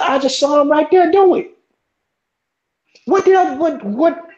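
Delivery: high, rattled retold exclamation; stunned retelling. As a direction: blurt it out in shock. Retelling shock to friend.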